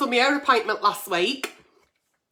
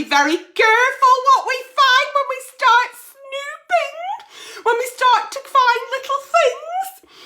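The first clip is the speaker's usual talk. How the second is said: high, hammed-up wail of caution; theatrical half-sob warning; mock wailing warning; 12 semitones higher than usual. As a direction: pretend to cry over snooping. Mock warning about phone snooping.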